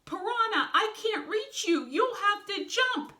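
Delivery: high, loud pleading call; urgent calling out. A narrator's reading. Wolf calls to Piranha stuck below, urgent.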